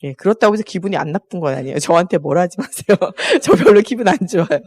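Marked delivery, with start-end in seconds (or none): 3.2-4.6 higher, through a laugh; laughing admission. Real, not acted. Preacher admitting to the congregation that criticism stings, laughing. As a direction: confess it jokingly, laughing.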